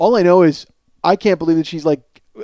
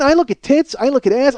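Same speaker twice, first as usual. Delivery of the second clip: ordinary talk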